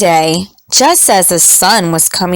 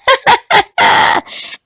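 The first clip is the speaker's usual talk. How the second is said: high cheeky cookie laugh; high taunting laugh. Acted. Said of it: "the gingerbread cowboy just laughed".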